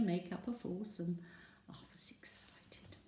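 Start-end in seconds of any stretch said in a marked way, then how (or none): 1.7-3.0 whispered. Real, not acted.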